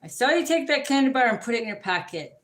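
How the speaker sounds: loud accusing call-out; calling out thief. Pretend. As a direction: point the finger and call them out. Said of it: "someone's a thief".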